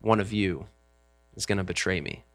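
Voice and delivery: low, quiet pronouncement; grave announcement from the head of the family; solemn, stern. Acted. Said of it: "says in a solemn, stern voice".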